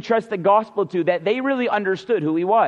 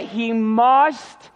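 MUST drawn out long and pushed hard; "must" held long for emphasis; emphatic MUST. Shown as a demonstration. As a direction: lean heavily on the word MUST. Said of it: "it's emphatic".

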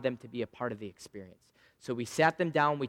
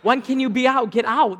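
much higher, hammed-up gloating voice; gloating taunt; 11 semitones higher, 9 dB louder than usual. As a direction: tease the seller in a silly put-on voice. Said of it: "I'm like".

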